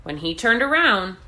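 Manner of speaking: ordinary delivery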